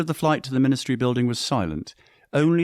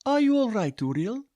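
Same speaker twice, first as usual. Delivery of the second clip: voiced young woman. A narrator's reading. Katerina asks after glasses shatter.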